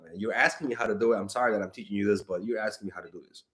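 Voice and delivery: ordinary talk, nothing marked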